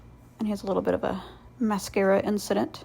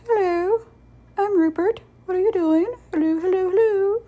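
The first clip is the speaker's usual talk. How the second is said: silly high duck voice; 11 semitones higher, 6 dB louder than usual. Pretend. Duck figurine voiced by painter.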